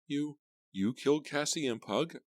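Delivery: high, frightened stammer. A narrator's reading. Girl confronting the killer, stammering. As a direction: stumble over the words in fear. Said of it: "I stammered".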